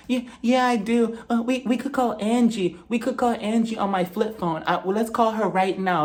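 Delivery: put-on high, pleading drunk voice; lightly voiced tipsy woman; high whiny voice. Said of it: "she was just like".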